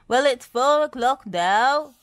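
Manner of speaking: loud, high worried voice; fretting. A narrator's reading. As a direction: fret aloud about the time. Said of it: "fretted Bob".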